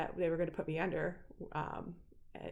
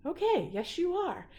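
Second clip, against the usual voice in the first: high, loud retold affirmation; emphatic approval; 10 semitones higher, 5 dB louder than usual. Patient to Nick who is now ready, approving. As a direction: cheer on the change, retelling it. She affirms his new attitude.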